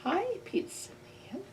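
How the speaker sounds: put-on high, soft little-kid hello; sweet child at the door; child greeting. Child greeting pizza man, high and soft.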